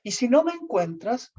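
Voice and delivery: high, mock-tragic farewell; milk the drama of disappearing; hammed-up melodramatic plea; theatrical martyr. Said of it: "eran unas actuaciones".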